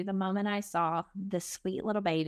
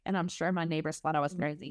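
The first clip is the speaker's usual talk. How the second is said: ordinary delivery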